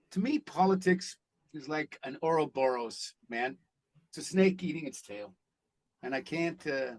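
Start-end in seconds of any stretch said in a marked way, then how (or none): none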